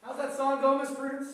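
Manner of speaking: high, loud eager voice. Acted. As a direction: cue her song eagerly. Host in high voice.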